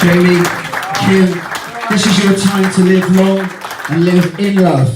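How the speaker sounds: solemn toast to the couple; formal toast. Real, not acted. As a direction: raise the glass with ceremony. Toast climax, formal address.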